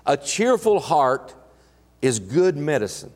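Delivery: slow, weighty reading of the verse; measured reverent scripture read. Acted. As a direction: lay each word down deliberately.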